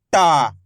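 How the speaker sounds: टा punched loud with a long falling aa; loud, stretched टा, dropping in pitch; forceful stress. Shown as a demonstration. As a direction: put force on टा and hold it. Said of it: "जोर लगा देना है".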